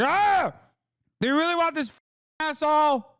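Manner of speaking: high, strained mock sputtering fury; mock angry Republican. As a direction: imitate a guy too mad to finish. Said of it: "Where they're like".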